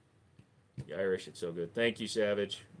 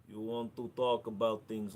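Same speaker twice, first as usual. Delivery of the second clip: ordinary talk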